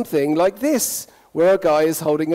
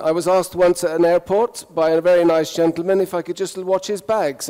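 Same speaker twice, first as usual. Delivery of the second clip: ordinary delivery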